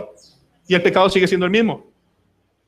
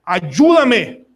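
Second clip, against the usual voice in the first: a raised, pleading cry to God; crying out for help; 5 semitones higher, 3 dB louder, much slower than usual. Retold or imitated. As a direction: call out, begging to be saved. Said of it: "todo el tiempo están gritando". (súplica en voz alzada, pidiendo ayuda)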